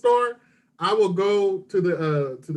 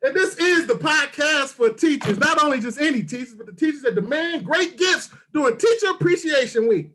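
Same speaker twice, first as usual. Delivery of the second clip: punchy, sing-out podcast host delivery; show intro; 7 semitones higher than usual. Acted. Host pitches the podcast for teachers, hyped intro. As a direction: sell the show's tagline with big emphasis.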